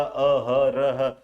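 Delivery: अहरह very slowly, each syllable apart; slow, sounded-out अहरह. Shown as a demonstration. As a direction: draw out अ-ह-र-ह as if hunting for the break. Puzzling out where word splits.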